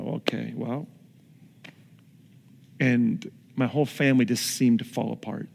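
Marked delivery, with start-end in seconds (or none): 0.0-0.9 much softer, let-down okay; deflated okay. Retold or imitated. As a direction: sag as the hope collapses. Deflated after divorce.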